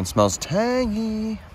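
high, slow, doubtful drawl; skeptical. Real, not acted. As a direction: stretch out tangy suspiciously. Sniffing the blue cheese.

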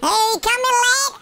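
usual speaking voice

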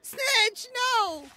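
high, loud alarmed cry of a name. Acted. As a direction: shout in panic as your partner drops. Alarm as partner seems dead.

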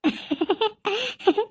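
joyful laugh. Acted. Ghost aunt laughs with joy.

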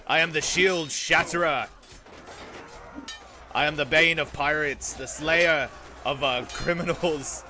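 loud, proud warlord boast; boastful raider. Acted. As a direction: declare your titles to the field. Boasting in character mid-battle.

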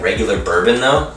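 normal talking voice

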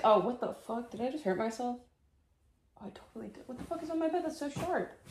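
high, surprised yelps; startled pain. Real, not acted. Vlogger pricking herself on her bed, high and startled. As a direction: react to a sudden sharp jab. Hurts herself on something sharp.

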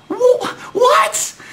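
high squeaked what; cracked, rising disbelief; a stunned, shrill-high what; disbelieving shout. Real, not acted. Disbelief at the booking.

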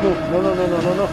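alto y fuerte, desesperado; grito desesperado. Real, not acted. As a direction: suplica en pánico. Lo golpean. (grito desesperado)

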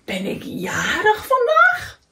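high, slow, rising disbelief; astonished. A narrator's reading. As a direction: ask it in wide-open amazement. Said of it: "zei Kikker verbaasd". (stomverbaasd, hoog en langzaam)

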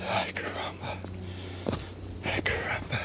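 soft, voiceless whisper; awed whisper. Real, not acted. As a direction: whisper it with pauses between. Hushed awe at big rock.

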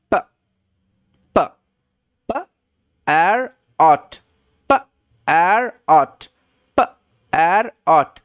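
"প, এর অট" as short p, gap, stretched ar, ot; sounding out. Shown as a demonstration. Teacher modelling parrot for learners, sounded out slowly. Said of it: "সাউন্ডটা হচ্ছে প".

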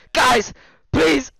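very high, loud frantic yells; panicked shouting. Real, not acted. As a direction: scream for help as he's hunted. Panicked in-game death scare.

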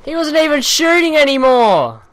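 high, loud furious yell into the mic; angry yell. Real, not acted. Gamer yelling into the mic at an unfair kill, furious. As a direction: rage at the enemy, full volume. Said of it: "I'm sorry for yelling into the mic".

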